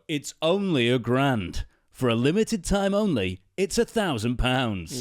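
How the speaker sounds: loud, slow, hammed-up sales pitch; pushy salesperson. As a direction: oversell it like a cheesy salesman. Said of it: "the traditional salesperson will go".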